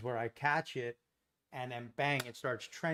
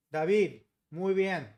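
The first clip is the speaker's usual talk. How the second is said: Spanish accent attempt, a bit louder and slower; trying a Spanish accent; 3 semitones higher, 7 dB louder than usual. Shown as a demonstration. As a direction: show off your best Spanish accent. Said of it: "How's my accent, David?".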